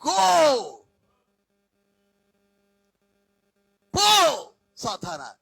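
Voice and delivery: loud, slow commanding shout; bellowed command, drawn out; shouting at Satan. Shown as a demonstration. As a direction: order the devil out at full force. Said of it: "ఎంత గట్టిగా అరవాలో అంత గట్టిగా అరవాలి".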